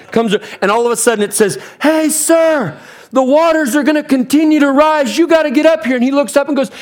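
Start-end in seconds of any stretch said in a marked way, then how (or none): 1.8-5.9 high, strained call to someone far off. Acted. Helicopter rescuer to a man on his roof, calling out high. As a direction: make it carry over the rotor blades.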